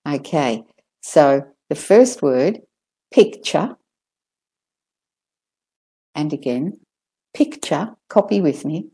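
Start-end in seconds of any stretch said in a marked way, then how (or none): 3.1-7.9 "picture" ending in r-less "chuh", Australian-British; Australian-British "picture" with a final schwa. Shown as a demonstration. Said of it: "Australian and British way first".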